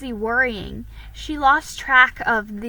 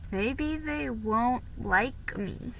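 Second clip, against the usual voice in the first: slow, soft worried thought; slow soft fretful thought; worried inner thought; 7 dB quieter, slower than usual. A narrator's reading. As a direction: hush it, worrying to herself. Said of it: "thought Felicity".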